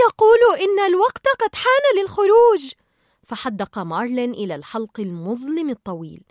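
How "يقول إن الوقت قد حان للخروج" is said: high, loud, urgent announcement; excited high-pitched cry; shouting out. A narrator's reading. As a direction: call it out at full pitch. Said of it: "فصاحت دوري".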